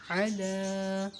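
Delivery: slow, drawn-out علا with ain from the mid-throat; drawn-out Quranic ala. Shown as a demonstration. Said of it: "عین کی آواز وسط حلق سے". (علا کو کھینچ کر، عین وسط حلق سے)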